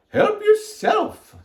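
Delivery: high, loud, drawn-out lady's voice; Missus Rogers. A narrator's reading. Missus Rogers offers food, high drawn-out voice. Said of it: "said Missus Rogers".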